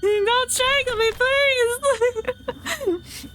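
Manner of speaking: very high, squealed mock plea; mock pleading. Streamer joking about being strangled, squeaky pretend panic. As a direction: beg for mercy in a silly squeal.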